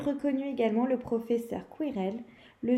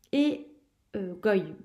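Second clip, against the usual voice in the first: ordinary delivery, nothing marked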